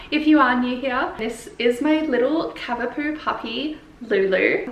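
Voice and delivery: ordinary talk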